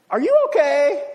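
called out loud and high over the sleet; calling out. Retold or imitated. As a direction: shout it across the street to her. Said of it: "he turns around and he goes".

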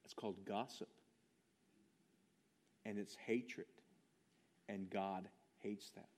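hushed. Shown as a demonstration. Preacher quietly condemning gossip to the church, low and hushed. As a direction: drop to a near whisper on And God hates that. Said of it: "repeats the line, now hushed".

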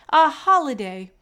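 excited announcement. A narrator's reading. McGuire announcing the treat.

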